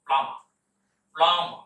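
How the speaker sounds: "প্লামা" said firmly, silent b and no final r; teacher models the right way: "প্লামা" with silent b; correct pronunciation. Shown as a demonstration. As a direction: model "প্লামা" loud and clear, two syllables. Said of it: "উচ্চারণ করবো আমরা".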